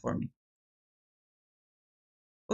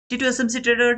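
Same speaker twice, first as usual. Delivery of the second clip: excited call; 8 semitones higher, 6 dB louder, faster than usual. Real, not acted. Calling out the hoped-for winner.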